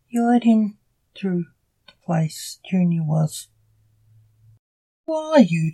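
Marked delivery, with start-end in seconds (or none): 5.1-5.7 high, falling demand. A narrator's reading. Captor questions tied-up Junior, demanding. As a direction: press the question, pitched up. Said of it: "demanded Crowbar Charlie for the thousandth time".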